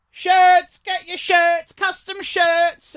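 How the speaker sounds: high, drawn-out sales call; market vendor's cry. Retold or imitated. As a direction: hawk your wares across a market. Market vendor calling out wares.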